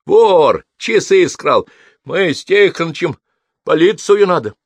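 high, loud triumphant outburst; excited servant raising the alarm; triumphant accusation. A narrator's reading. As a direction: crow over a caught thief, loud and high. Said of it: "с торжествующим видом".

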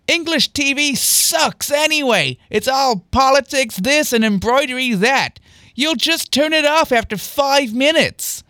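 high, slow sneering complaint; sarcastic. A narrator's reading. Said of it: "with an apparent tone of sarcasm".

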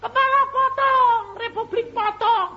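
very high, loud chanted yell; high-pitched imitated war cry; acted attackers' shout. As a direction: yell it like a mob calling for blood. Acts out attackers' threats.

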